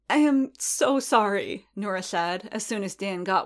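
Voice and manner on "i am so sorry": high, drawn-out, upset woman's plea; tearful apology. A narrator's reading. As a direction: apologize in a high, slow, distressed voice. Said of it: "Her eyes were bright with tears".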